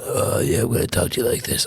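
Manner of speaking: low, breathy creepy villain imitation; creepy villain whisper. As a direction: mimic a hissing villain. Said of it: "makes it sound like he's a fucking brain worm".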